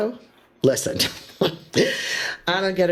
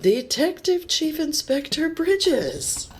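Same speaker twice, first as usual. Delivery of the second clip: hailing greeting; 11 semitones higher than usual. Acted. Local greeting the detective by full title, high hailing voice.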